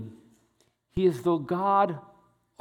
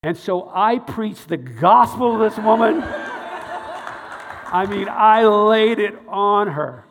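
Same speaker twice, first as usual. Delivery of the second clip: much louder, laughing, slowing on the punch; laughing boast; 6 semitones higher, 10 dB louder than usual. Real, not acted. Telling the church how he preached to her, loud laughing boast. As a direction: crow about it with a laugh.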